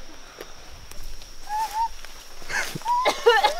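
high squealing coughing laugh; choking laugh. Real, not acted. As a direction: choke and laugh at once. Bug flew into her throat.

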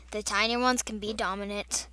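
normal talking voice

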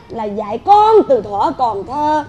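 slow, drawn-out saying; pointed proverb recital. Acted. Mother lectures her in-law with old wisdom, slow and pointed. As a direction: stretch each phrase of the proverb.

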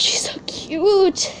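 high, drawn-out coo. Real, not acted. Collector gushing over the panda toy, high coo. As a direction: stretch it out adoringly in a high voice.

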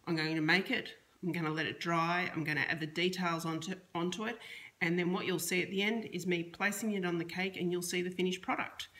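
usual speaking voice

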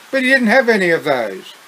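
ordinary delivery

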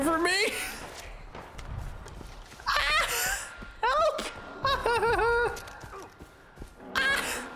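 high terrified screams; panicked shrieking. Real, not acted. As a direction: scream and shriek while fleeing. Chased by boss again.

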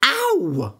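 sharp high "Ow!"; cry of pain. Acted. Jack bitten by the harp, sharp high yelp. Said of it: "cried Jack".

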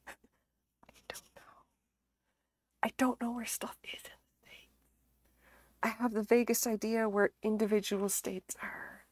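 hushed, embarrassed confession; sheepish whisper. Real, not acted. Streamer confessing poor geography to viewers, sheepish whisper. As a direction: admit it under your breath, almost voiceless.

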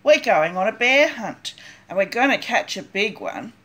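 usual speaking voice

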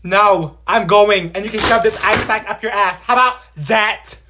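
loud, furious catchphrase yell; angry shout. Acted. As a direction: shout it out in a rage. Angry teacher storming off.

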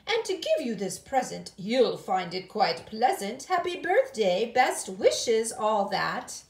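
loud, jaunty gentleman's voice; hearty birthday wishes from a dandy; dapper cousin. Acted. Cousin handing over a birthday present, jaunty and loud.

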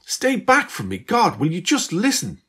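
high, loud screech to keep away; girl fighting off her double, screeching. Acted. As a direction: shriek at her to stay back. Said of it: "Beth screeched and fought her off".

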